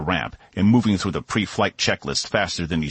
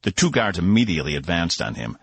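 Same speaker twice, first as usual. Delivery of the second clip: plain reading voice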